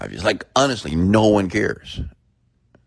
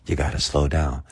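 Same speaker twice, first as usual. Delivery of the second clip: normal talking voice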